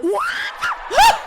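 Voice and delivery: very high, loud shocked shriek. Real, not acted. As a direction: shriek in disbelief. Shocked at the award result.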